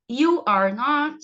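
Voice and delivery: YOU ARE NOT slow and loud, word by word; model pronunciation. Shown as a demonstration. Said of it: "vão repetindo aí comigo". (pronúncia modelo, lenta e forte)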